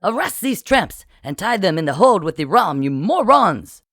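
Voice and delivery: high, loud exasperated command; angry shout peaking on the final insult; shouted order. A narrator's reading. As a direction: bellow orders at useless underlings. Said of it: "as Francois shouted".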